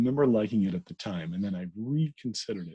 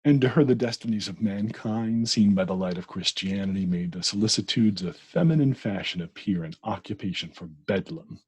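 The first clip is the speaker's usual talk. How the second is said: reading aloud; 5 dB louder than usual. Acted. Re-reads passage aloud.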